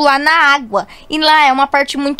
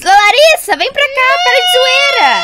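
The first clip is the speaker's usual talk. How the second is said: high, urgent calling shout; 8 semitones higher, 4 dB louder than usual. Acted. Girl ordering her joking sister back to shore, shouting. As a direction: yell at her to come back at once.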